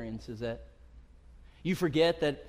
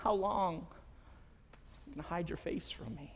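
much softer, slow, aching question; hurt plea; 10 dB quieter, slower than usual. Retold or imitated. David quietly pleads with a hidden God, hurt. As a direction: let the hurt show as the voice drops away.

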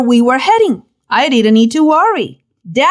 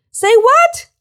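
much higher, startled exclamation; a playful jolt of disbelief; mock surprise; 13 semitones higher than usual. Preacher voicing a stunned disciple, mock surprised.

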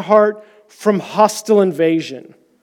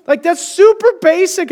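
amazed quote; 9 semitones higher than usual. Retold or imitated. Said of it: "I was like".

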